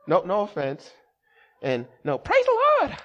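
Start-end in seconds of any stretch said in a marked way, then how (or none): 2.2-3.0 much higher, sing-song churchy greeting; mock the too-blessed saints; overly cheery church folks. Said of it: "I'm like, you know".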